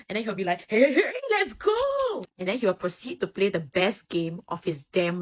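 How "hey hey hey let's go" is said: high, loud, drawn-out hype yell; excited cheer. Acted. Bokuto fired up for the game, excited cheer. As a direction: explode with hammed-up excitement, stretching the words. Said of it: "his eyes will sparkle".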